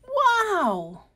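thrilled exclamation on first flight; amazed. Acted. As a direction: burst out with wonder. Flying high for first time.

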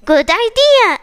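a touch of excitement; pleased exclamation. Acted. Snake approving the trip, a touch excited. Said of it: "exclaims the snake".

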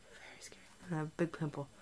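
hushed confiding whisper. Real, not acted. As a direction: drop to a whisper. Confiding about her sick face.

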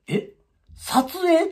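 high surprised echo; mock surprise. Waiting patients surprised by the word, mock surprise. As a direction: echo it like stunned bystanders.

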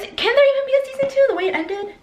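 high, fast disbelief; incredulous. Real, not acted. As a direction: squeal it in disbelief. Disbelief about a season two.

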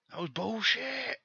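outraged high-pitched shout; real angry yell, pitch shooting way up; pissed off. Real, not acted. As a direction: cry foul at the top of your voice. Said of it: "why I got so fucking pissed off".

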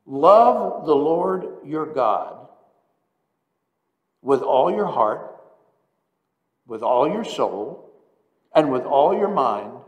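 slow, loud, measured proclamation; preacher declaiming the commandment; solemn scripture recital. Acted. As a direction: lay each phrase down with weight. Reading the great commandment.

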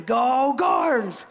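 rally the family like a huddle; mock-rousing family cheer; team cheer.

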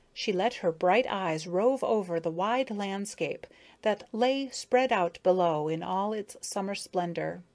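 ordinary delivery

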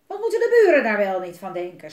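loud furious outburst; dad stamping his foot in anger; stamping angrily. Acted. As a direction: rage about the neighbours. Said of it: "stampvoette hij".

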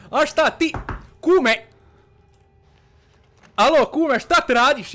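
loud, high shout to his buddy; calling out. Real, not acted. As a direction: call out across the house. Calling to friend in the house.

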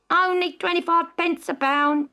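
high, calling out a bargain; a stallholder crying his wares; market shout. Acted. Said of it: "shouted the stallholder".

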